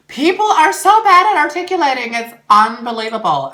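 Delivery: very high, loud complaint; exasperated outburst. Real, not acted. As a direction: burst out shouting in disbelief. Outburst about bad articulation.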